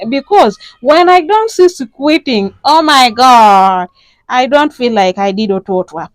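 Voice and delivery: hammed-up desperate plea for help; pleading caller. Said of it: "those are the kind of calls I get".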